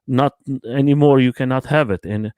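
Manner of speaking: normal talking voice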